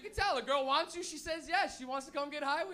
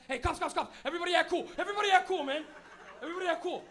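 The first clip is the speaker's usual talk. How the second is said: loud, raised mock panic; panicked warning; 4 semitones higher, 7 dB louder, faster than usual. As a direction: frantically warn the whole car. Panicked passenger warns car on cops.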